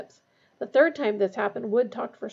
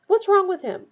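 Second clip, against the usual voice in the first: high, loud, urgent question; worried demand; 9 semitones higher, 7 dB louder than usual. A narrator's reading. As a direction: press urgently for an answer. Harry worried, defies Snape.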